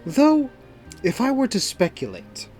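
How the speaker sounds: practiced, easy speculation; Calor, smooth and practiced. A narrator's reading. Calor explaining the gem, smooth and practiced. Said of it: "His tone is smooth".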